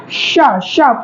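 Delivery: शा held long with a puffed tongue; teacher demonstrating the शा sound to students, slowly drawn out. Shown as a demonstration. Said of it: "इसको शा बोलने के लिए".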